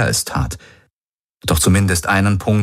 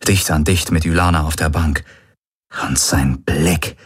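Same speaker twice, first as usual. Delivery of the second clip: ordinary delivery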